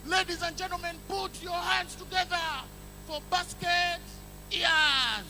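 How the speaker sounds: lightly voiced event MC; MC announcement. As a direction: announce it like a stage MC. MC announcing wrong artist.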